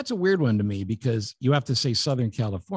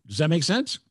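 usual speaking voice

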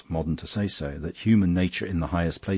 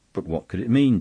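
louder, higher demand; raised, pressing question; 5 semitones higher, 6 dB louder than usual. A narrator's reading. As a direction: press the priest for an answer. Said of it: "demanded the diner opposite".

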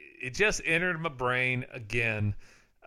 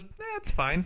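shrug; 7 semitones higher, slower than usual. Retold or imitated. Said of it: "He goes".

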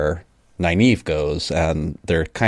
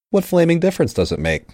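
ordinary talk, nothing marked

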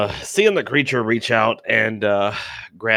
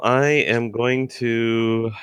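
ordinary delivery

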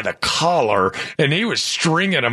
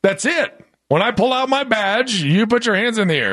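hammed-up cop; 6 semitones higher than usual.

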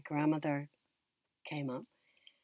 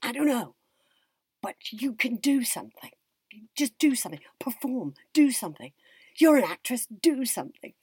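higher and louder, pressing hard; frantic urging; 8 semitones higher, 9 dB louder than usual. Retold or imitated. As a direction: push her to act, half panicked. Panicked grandma urges her to perform.

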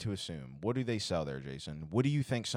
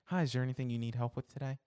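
higher, brisk store greeter; chirpy retail greeting, quick and high; customer-service voice; 5 semitones higher, faster than usual. Shown as a demonstration. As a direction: put on your work voice for a customer. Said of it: "this is only how I talk at work".

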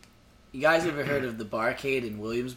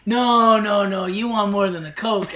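louder retold insistence, no no no; pushy insisting; 5 semitones higher, 7 dB louder than usual. To the guy who asked for a Coke, insisting loudly on beer. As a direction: overrule his Coke order, raising the voice.

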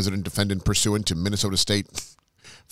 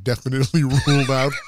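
louder and higher, cracking up mid-sentence; laughing through the words; 6 semitones higher, 6 dB louder, faster than usual. Real, not acted. Co-host cracks up reading the lawsuit aloud, laughing. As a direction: try to read on but keep laughing.

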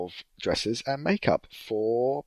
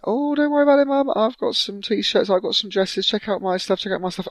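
high, loud show-off voice; mocking sister; 14 semitones higher, 5 dB louder than usual. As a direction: tease the brother mercilessly.

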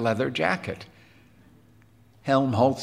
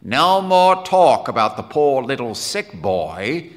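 loud, grim refusal; forceful, no-nonsense dismissal; 3 semitones higher, 7 dB louder than usual. Acted. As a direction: put your foot down firmly. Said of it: "said Quinn grimly".

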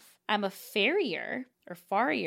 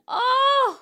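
high, loud delighted "Oh!"; sudden delighted gasp of a word; surprised; 8 dB louder than usual. Real, not acted. Opening the fan's gift package.

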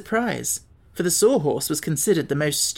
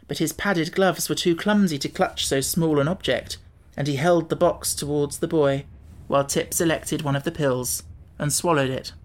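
ordinary delivery, nothing marked

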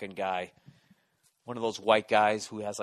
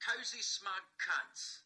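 English accent; 7 semitones higher, 9 dB quieter, slower than usual. Shown as a demonstration. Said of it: "in the English accent".

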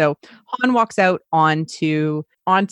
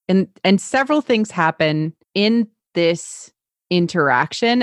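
usual speaking voice

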